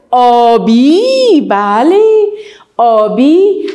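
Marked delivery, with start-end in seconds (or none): 0.1-1.5 teacher stretching the word slowly; slow drawn-out. Shown as a demonstration. Teacher modelling a word for children, slowly drawn out. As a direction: draw the word out for the class. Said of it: "آسمان چی رنگ است؟".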